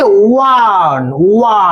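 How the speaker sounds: ওয়ান stretched slowly, then ওয়া cut down to its opening "wa"; teacher isolating the long "wa" that opens ওয়ান; pronunciation demo. Shown as a demonstration. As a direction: draw out the "wa" sound in ওয়ান, then give ওয়া alone. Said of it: "উচ্চারণটা কিসে হচ্ছে?".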